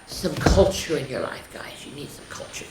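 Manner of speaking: breathy whispered aside. Real, not acted. Reader whispering a joke aside to the viewers.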